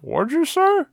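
high, mouth full of burger. Retold or imitated. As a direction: ask through a mouthful. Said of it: "now with a mouthful of tiny hamburger".

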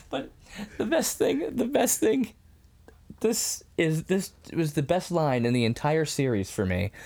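ordinary talk

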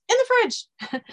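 very high mouse squeak. Acted. Third mouse chiming in, high squeak. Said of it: "the third mouse says".

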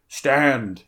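loud, firm command to halt; sharp stern challenge; stern order. A narrator's reading. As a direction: order them to stop. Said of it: "he ordered".

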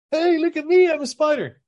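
high, loud cartoon bunny squeal; bunny showing off its new eyes, squeaky and loud. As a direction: show off like a silly cartoon bunny. Bunny voiced in a joke.

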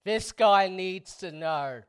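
loud, emphatic declaration; ranting troll. Retold or imitated. Podcast host reads out an online troll's verdict on himself, mock-pompous troll. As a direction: proclaim it with heavy weight on the words. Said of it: "one particular troll on that that was like".

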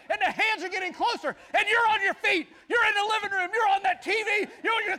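loud, high, building ringside commentary; excited play-by-play. Acted. Preacher acting out the match for the congregation, shouting and excited. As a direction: call the match like a frenzied announcer.

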